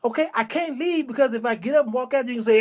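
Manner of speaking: usual speaking voice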